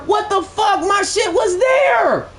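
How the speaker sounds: sudden high yelled what the fuck; outraged shock. Real, not acted. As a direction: caught off guard, yelling up high. Outbid at the last second.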